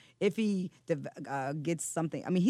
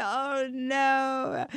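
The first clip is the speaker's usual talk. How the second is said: dismayed oh no; 9 semitones higher, 5 dB louder than usual. Retold or imitated. Mom reacts to son's vomiting.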